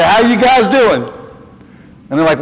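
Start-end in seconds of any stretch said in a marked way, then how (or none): 0.0-1.0 high, careful Southern greeting that drops the g in doing; a country boy trying to sound un-Southern, higher; hiding Southern accent. Retold or imitated. Valet greeting customers, forced neutral accent. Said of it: "So I'd say".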